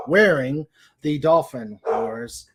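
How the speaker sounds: ordinary talk, nothing marked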